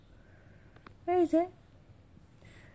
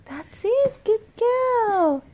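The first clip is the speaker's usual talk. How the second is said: delighted praise; 11 semitones higher, slower than usual. Real, not acted. Parent praising toddler for finding the picture, delighted. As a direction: cheer the little one on, voice lifted high.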